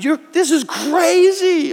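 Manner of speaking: stretched-out disbelief; squeaky disbelieving outburst; incredulous exclamation. Retold or imitated. Pastor's reaction to prompting.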